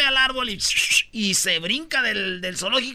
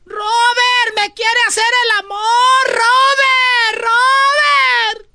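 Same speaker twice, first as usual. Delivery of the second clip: high, loud shrieking for her husband; hammed-up high screaming wife; screaming woman; 9 dB louder, much slower than usual. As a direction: shriek for Robert in mock terror. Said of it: "gritó doña Agripina".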